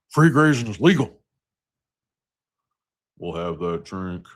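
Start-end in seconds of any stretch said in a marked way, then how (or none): 0.1-1.2 higher, louder drawled cowboy line; hammed-up movie cowboy; Kevin Costner impression.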